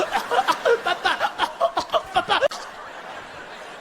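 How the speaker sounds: high strangled gagging; mock choking. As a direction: choke out broken, high squeaks. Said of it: "Jean-Pierre is choking".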